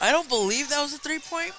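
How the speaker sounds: shocked disbelief. Real, not acted. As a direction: gasp at the shot in disbelief. Disbelief at a three-pointer.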